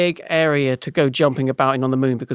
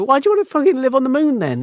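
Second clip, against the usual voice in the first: high, fast jeering mate voice; mocking mates; 10 semitones higher, faster than usual. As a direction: scoff at him like a rowdy mate. Mates mocking Bob's moon plan.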